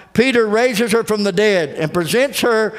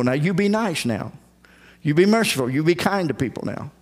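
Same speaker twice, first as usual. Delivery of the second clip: softer, sing-song nag to a schoolchild; scolding a child; 4 semitones lower, 3 dB quieter, faster than usual. Retold or imitated. Preacher mimicking prayers that treat God like a child going to school. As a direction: talk down like a parent at the door. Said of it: "like he's a little child going off to school".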